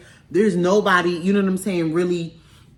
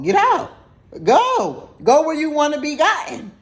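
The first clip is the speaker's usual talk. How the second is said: sharp, higher, louder order to leave; telling him to get out; 8 semitones higher, 3 dB louder than usual. Retold or imitated. To the date she is throwing out, a loud high command. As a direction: bark it out like kicking him out.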